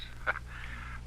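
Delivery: breathy little laugh under the breath; a quiet knowing chuckle; soft unvoiced chuckle. Official laughs off the threat.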